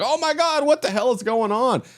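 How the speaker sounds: high-pitched mock panic. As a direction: squeak it out in a fake panic. Panicked man quoted.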